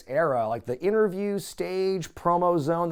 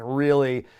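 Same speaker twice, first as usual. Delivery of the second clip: ordinary talk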